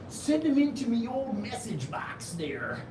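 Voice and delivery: lightly voiced old-fashioned oldster; old person voice. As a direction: play a creaky old grandpa. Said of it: "I sound like such an old person".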